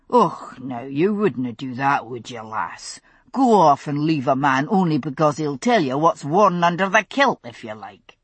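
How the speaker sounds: put-on a light teasing Scots accent; Scottish brogue. Roger teasing Brianna in a light Scottish accent. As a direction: tease her in a hint of Scottish brogue.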